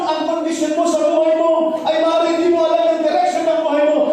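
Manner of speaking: high, loud sung-out preaching; fervent preaching. Acted. Pastor exhorts the church members, fervent preaching. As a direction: preach with rising fervour.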